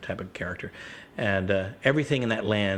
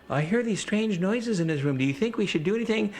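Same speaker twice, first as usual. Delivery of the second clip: worried parents; 11 semitones higher than usual. Retold or imitated. Parents in the story, voiced.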